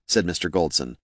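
ordinary delivery

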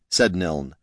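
plain reading voice

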